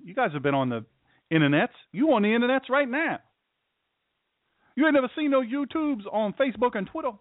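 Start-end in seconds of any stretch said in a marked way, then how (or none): 1.9-7.1 high-pitched comic disbelief; mock incredulity. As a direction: tease them in a high, put-on voice. Mock incredulous jibe at listeners.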